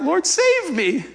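high, slow plea; a lightly voiced desperate call; cry for help. Peter sinking, calling to Jesus in mock fear. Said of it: "cried out".

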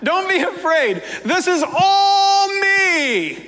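high, lightly voiced reassurance, slowing at the end; reassuring. Jesus calming his terrified disciples, mildly reassuring. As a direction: soothe frightened friends with a half-smile. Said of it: "Jesus immediately said to them".

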